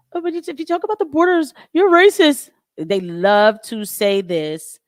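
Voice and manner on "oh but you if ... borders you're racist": mock the critics in a high whine; mocking whiny quote. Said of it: "what do they say?".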